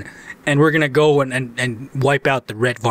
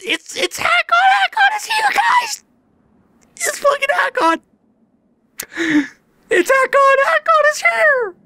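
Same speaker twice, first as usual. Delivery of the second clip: far higher, shrieking fanboy joy; ecstatic squeal. Real, not acted. Streamer spotting his favourite character, squealing with excitement. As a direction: squeal like a fan seeing his hero. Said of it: "I'm excited".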